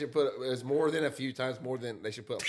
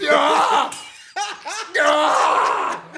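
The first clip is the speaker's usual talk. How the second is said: high, loud screaming; mock waking scream; 14 dB louder than usual. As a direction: scream to wake the whole house. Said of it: "Just go".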